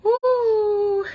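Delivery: long, very high "woo" to shake off tears; real teary whoop, held on one high note; high tearful woo. Real, not acted. Host fighting back tears on her live show, tearful woo. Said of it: "Chill out on the crying".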